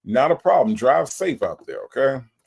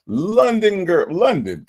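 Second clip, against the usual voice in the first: high, slow, drawn-out call of the name; sing-song welcome; 9 semitones higher, 5 dB louder, slower than usual. Real, not acted. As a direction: stretch the name out like a host. Greeting a viewer.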